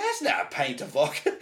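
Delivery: put-on laughing take on a Glasgow accent; imitated Glasgow accent. Amused clerk corrects the customer, imitated Glasgow accent. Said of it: "I started doing her accent".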